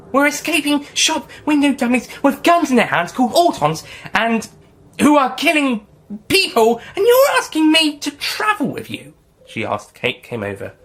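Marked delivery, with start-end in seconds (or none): 0.1-9.1 high, loud disbelief; can you believe this? higher and louder; incredulous. Acted. Incredulous outburst.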